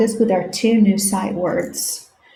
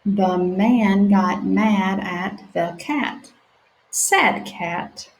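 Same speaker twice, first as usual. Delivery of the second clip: stretched-out sounding out; slow sounding out; slower than usual. Shown as a demonstration. Teacher reading slowly with children. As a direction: read each word slowly for beginners. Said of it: "let's read them together".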